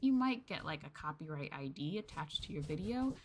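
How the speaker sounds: usual speaking voice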